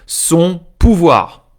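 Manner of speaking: son pouvoir slow, high and loud, each syllable leaned on; stress on pouvoir. Real, not acted. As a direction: land hard and slow on pouvoir. Stressing key idea of power.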